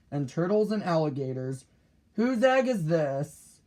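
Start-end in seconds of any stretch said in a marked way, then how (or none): 2.1-3.6 higher, drawn-out call. A narrator's reading. Boy asking around after a found egg, calling out. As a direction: call out slowly to find the owner. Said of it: "he called".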